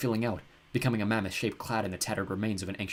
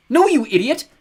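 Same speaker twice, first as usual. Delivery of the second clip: high, much louder shout; 13 dB louder than usual. Acted. Mallory shouts at her brother not to give up, loud. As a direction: yell to stop him. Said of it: "Mallory shouted".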